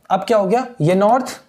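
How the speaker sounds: normal talking voice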